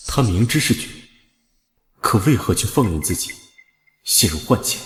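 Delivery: soft, inward reasoning to oneself; pensive musing. Acted. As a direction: think it through quietly, phrase by phrase. Detective reasoning alone to himself.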